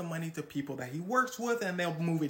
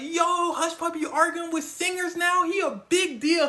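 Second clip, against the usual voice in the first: loud, much higher excited shout; hyped crowd; 12 semitones higher, 9 dB louder than usual. Retold or imitated. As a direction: hype him up like fans going wild. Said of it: "Everyone's like".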